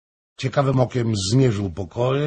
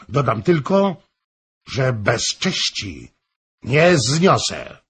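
loud, higher, emphatic threat; stern outburst, leaning hard on the key words; exclaimed warning; 4 semitones higher, 7 dB louder than usual. A narrator's reading. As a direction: lay down the law in a raised voice. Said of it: "zawołał".

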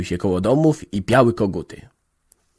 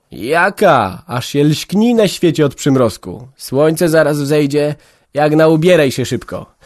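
put-on brisk old village mother, louder; 5 semitones higher, 6 dB louder than usual. A narrator's reading. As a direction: hurry the girl along, motherly. Mother voiced in story. (energiczna stara matka)

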